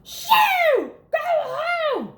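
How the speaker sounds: high, loud shout to shoo the dog; shooing yell. A narrator's reading. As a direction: chase him off with your voice. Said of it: "one of the lunch ladies yelled".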